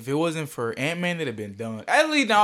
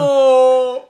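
sudden loud oh; surprised oh; 6 semitones higher, 9 dB louder than usual. Real, not acted. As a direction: react startled. Sudden realisation mid-chat.

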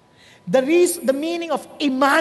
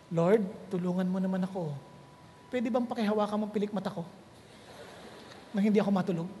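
mock sleepy prayer; 5 semitones lower, 7 dB quieter, faster than usual.

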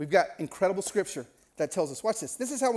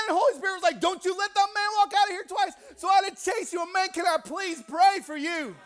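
frantic shouting; 12 semitones higher, 5 dB louder than usual. Retold or imitated. Stranger who chased Marcus begging to pray, frantically shouting. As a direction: shout it out in a mounting desperate plea.

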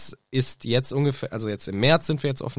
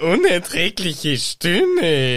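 prim, indignant, louder and a touch higher; two snooty ladies trading insults; haughty Umbridge quarrel; 3 semitones higher, 4 dB louder than usual. Pretend. (empört-affektierte Umbridge-Stimme)

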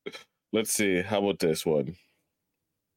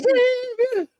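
sudden high squeal; zing voice. Pretend. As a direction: shriek the word Zing way up high. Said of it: "you have to do it in a zing voice".

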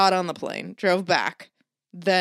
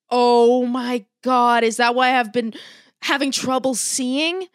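mom's shocked realization; 3 semitones higher, 5 dB louder than usual. Retold or imitated. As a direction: play the dazed mom finally getting it. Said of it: "And she was like".

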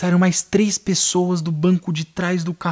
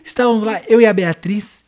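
high, loud dramatic narrator; mock-epic storytelling; 5 semitones higher, 5 dB louder than usual. Telling the lost-at-night story like a cartoon adventure, mock-epic. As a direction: announce it like a fantasy tale. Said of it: "Caverna do Dragão".

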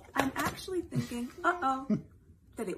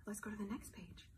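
whisper; 13 dB quieter than usual. Acted. Storybook page turn whisper.

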